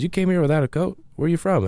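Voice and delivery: imitating a cashier's motherly scolding. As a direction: scold him like a worried mom. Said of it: "in a very motherly way".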